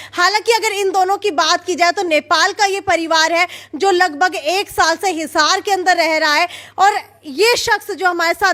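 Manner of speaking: loud, urgent field-reporter delivery; news report. Acted. Reporter describing the assault to viewers, urgent news read. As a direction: report the attack to camera with urgency.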